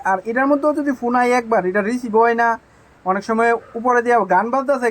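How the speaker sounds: usual speaking voice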